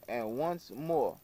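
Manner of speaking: usual speaking voice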